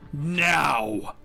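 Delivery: sudden forceful order, not yet screamed; firm command. Acted. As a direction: punch the word out as an order. First take of the woman's order.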